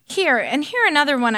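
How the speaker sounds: understated narrated wail; a touch of distress, read aloud. A narrator's reading. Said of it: "I wailed".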